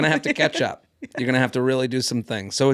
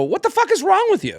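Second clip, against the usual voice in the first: much higher, exasperated mock yell; retold yelling. Man confronting the woman as the cab leaves, exasperated yell. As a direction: act out baffled outrage. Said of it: "as I'm yelling at Samantha".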